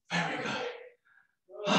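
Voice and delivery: breathless, airy praise; out of breath. Real, not acted. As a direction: gasp it out, slow and winded. Breathless after the exercise set.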